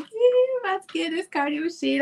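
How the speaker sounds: put-on high, cocky gym-girl voice; breezy young show-off; mock confident younger self. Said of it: "I'm like".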